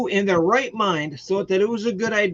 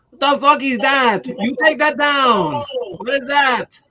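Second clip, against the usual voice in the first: loud, high Russian dad yelling; Russian accent; 7 semitones higher, 5 dB louder than usual. Retold or imitated. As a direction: bark it like an angry Russian father. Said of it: "in, like, his Russian accent".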